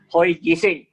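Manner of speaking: urging the Filipino community to wake up, called out loud. Shown as a demonstration. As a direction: call out to rouse a sleeping crowd. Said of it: "is what I'm saying in Filipino".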